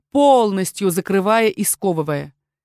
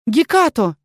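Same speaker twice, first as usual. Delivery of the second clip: calling out; 4 semitones higher, 4 dB louder than usual. Acted. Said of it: "Я повторила окрик".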